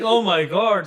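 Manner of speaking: high, loud mock shock; overwhelmed reaction, half laughing; retold amazement. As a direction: exclaim in exaggerated disbelief. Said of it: "it's like".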